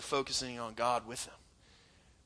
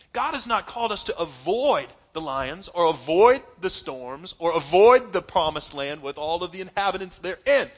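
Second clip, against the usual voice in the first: ordinary talk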